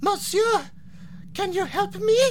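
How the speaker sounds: put-on high, small girl voice with French accent; pleading French child; little French girl. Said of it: "she says, the poor wastrel".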